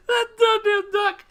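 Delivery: high-pitched, teary exclamation; real laugh-crying voice; crying with laughter. Real, not acted. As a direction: squeal it, worn out from laughing. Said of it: "I'm dying and I'm crying".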